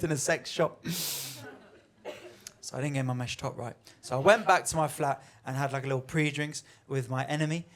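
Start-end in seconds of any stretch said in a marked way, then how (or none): none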